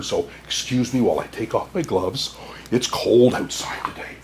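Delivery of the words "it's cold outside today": unvoiced aside whisper. Santa whispering to children about the cold. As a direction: hush it like a secret.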